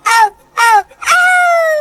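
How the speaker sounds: high drawn-out "oh" cries; mock yelling. Son yells in his song about his girl, high mock cries. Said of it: "Now I'm yelling".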